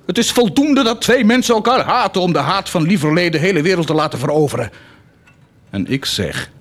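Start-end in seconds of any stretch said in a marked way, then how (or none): 0.1-4.7 quoting Heinrich. Retold or imitated. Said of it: "Heinrich zei".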